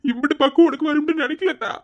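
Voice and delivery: father's high, emotional wonder at daughter; overwhelmed pride. Acted. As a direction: marvel at her, voice rising.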